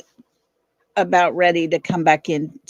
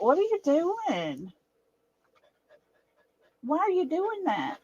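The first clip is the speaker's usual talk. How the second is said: higher and softer, asking the dog what it's doing; high puzzled pet talk; 8 semitones higher, 7 dB quieter than usual. Real, not acted. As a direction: coax a fussing dog with a raised, hushed voice. Scolding her restless dog.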